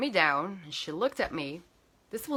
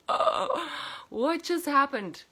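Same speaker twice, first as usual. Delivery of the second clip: higher, dazed question; stunned; 6 semitones higher, slower than usual. Retold or imitated. Stunned after the call.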